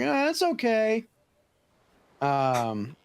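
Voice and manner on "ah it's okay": put-on kid voice. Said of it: "He's like".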